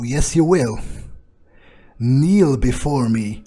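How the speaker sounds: ordinary delivery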